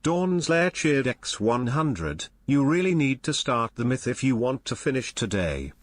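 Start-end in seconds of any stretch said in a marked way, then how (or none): none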